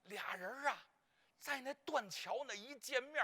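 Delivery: usual speaking voice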